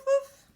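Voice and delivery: high, loud woof; a loud, high yappy woof; dog woof. Shown as a demonstration. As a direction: bark it out like a happy dog. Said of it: "the dog says woof".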